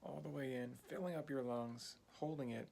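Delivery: soft, unhurried breathing guide; guided meditation. Acted. Teacher guiding a beginner's breathing exercise, soft calm meditation voice.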